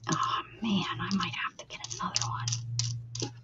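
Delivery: defeated whisper to herself. Real, not acted. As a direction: whisper it, giving up. Whispering, frustrated with the tool.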